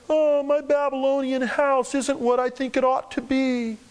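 mock whiny complaint. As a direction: play a half-hearted whiner.

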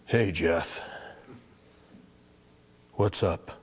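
low, flat, reluctant greeting; irritated. Retold or imitated. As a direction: let the annoyance show as you answer the phone. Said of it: "irritation in my voice".

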